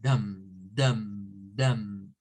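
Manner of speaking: low DUM with a schwa vowel; teacher modelling the weak syllable dum; schwa demo. Shown as a demonstration. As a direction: sound out dum slowly, neutral uh inside. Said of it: "It's the schwa zone".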